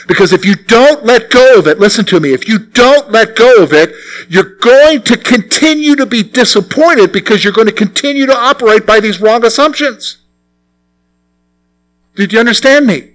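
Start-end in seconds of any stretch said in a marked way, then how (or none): none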